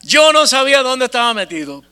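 emphatic admission. Real, not acted. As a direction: hit it hard, "Yo no sabía" up high. Repite la frase con otra fuerza. (afirmación enfática, alta y fuerte)

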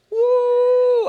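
high, loud, sustained "woo"; excited whoop. Real, not acted. Preacher delighting in his point to the congregation, whooping. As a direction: let out a long high whoop.

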